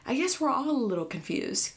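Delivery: dawning surprise; surprised realization. Retold or imitated. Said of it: "don't be afraid to be like".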